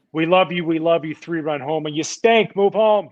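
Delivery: mock crowd yell, building to a heckle; imitated fan yelling. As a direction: mimic fickle fans hollering at a player. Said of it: "they're way quicker to yell at you".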